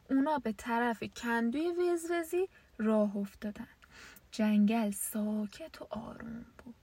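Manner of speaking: ordinary delivery, nothing marked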